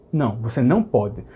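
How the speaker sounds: ordinary talk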